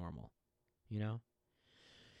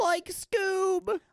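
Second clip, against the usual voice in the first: very high, loud cartoon cry; cartoon impression; 8 dB louder than usual. Host starts a Scooby cartoon bit, high and loud. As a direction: squeal it out loudly.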